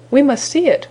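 high-pitched, lightly excited exclamation; eager cry. A narrator's reading. Merry Little Breezes keen to see the new egg, crying out eagerly. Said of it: "cried the Merry Little Breezes".

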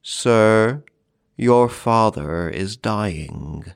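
slow, hollow-voiced servant; solemn old servant, each word drawn out. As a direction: deliver grave news slowly and hollowly. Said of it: "in a hollow voice, the solemn words".

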